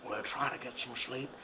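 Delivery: hushed bedtime whisper. Acted. Father whispers to sick daughter at bedtime. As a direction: whisper so as not to wake anyone.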